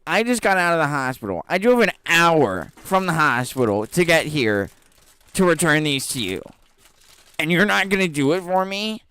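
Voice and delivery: high, loud mock whine; whiny customer. Retelling the customer's hospital excuse, a high loud mock whine. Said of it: "She's like".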